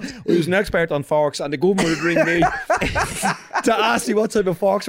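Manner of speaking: laughing through a mock quote. Mocking the pal's fork story, laughing. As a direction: giggle through the fork expert bit. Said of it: "you're going".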